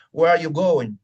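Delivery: loud, run-together phrase at normal speed; whole phrase in one go, firmly; said as one chunk. Shown as a demonstration. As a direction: say "Where are you going" as one connected chunk. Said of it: "Cuando yo digo".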